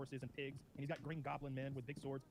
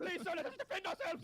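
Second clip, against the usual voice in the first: high, loud, laughing mock plea; pleading soldier; 6 dB louder than usual. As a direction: squeal it like a doomed little soldier begging for mercy. Voicing the helpless soldiers.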